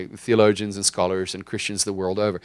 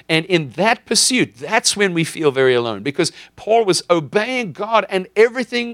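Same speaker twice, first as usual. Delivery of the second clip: higher, louder pulpit climax; preaching at full force; 9 semitones higher, 4 dB louder than usual. Acted. Preacher pressing on the congregation about Paul's struggle, rising and loud. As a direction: build the sermon to its peak.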